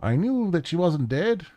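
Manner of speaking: high-pitched, excited man with urgency in his voice; jittery, urgent. Acted. Man tells Hermie they have found her, jittery and urgent. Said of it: "His speech is jittery. You can hear the urgency in his voice".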